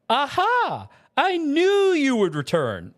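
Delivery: high, loud, flamboyant exclamation; Summoner James. Acted. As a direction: crow it theatrically, stretching the words.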